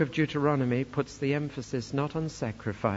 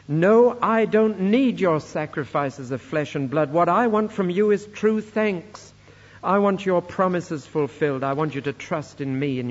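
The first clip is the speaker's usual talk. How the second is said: loud, high-pitched proclamation; forceful scripture declamation; 4 semitones higher, 7 dB louder than usual. Acted. Preacher reads God refusing flesh sacrifices, forceful declamation. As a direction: proclaim it emphatically, hitting the first word hard.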